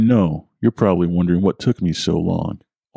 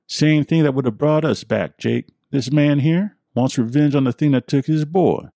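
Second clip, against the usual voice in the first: put-on much higher, knowing and hard old-timer; old man with accent; 7 semitones higher than usual. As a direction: lay it out plain, eyes narrowed. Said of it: "Henry looked at me hard".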